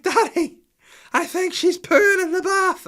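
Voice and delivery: put-on high, loud, slow child's wail; Holly. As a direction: wail it like an alarmed little girl. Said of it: "And she was like".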